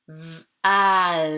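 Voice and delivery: "V. Al." with a long A and held L; drawn-out blending. Shown as a demonstration. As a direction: blend the sounds slowly, holding the final L. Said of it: "La lettre L".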